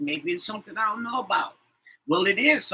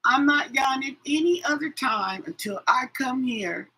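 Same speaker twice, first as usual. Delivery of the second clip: normal talking voice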